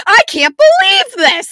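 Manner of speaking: very high, loud outburst; shocked outburst. Retold or imitated. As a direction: shriek it in disbelief. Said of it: "I was like".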